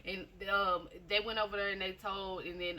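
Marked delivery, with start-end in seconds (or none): none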